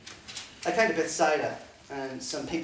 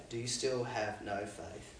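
soft rebuke; 6 semitones lower, 9 dB quieter, slower than usual. Acted. Jesus questions disciples.

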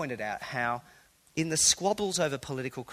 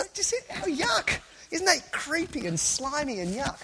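mock disgust; 12 semitones higher than usual. Preacher recoils at the idea.

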